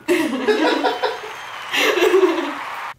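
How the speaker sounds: bright burst of laughter; laughing. Real, not acted. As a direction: laugh it off high. Laughs after her intro.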